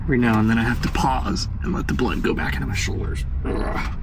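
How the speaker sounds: cramped and straining in a tight space; squeezed in, talking through the strain; being squeezed. Real, not acted. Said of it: "I'm crushing my ribs in here".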